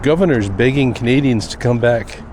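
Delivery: ordinary talk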